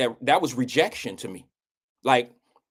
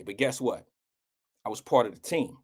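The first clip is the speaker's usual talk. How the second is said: usual speaking voice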